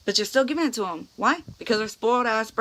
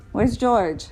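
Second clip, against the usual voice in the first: ordinary talk, nothing marked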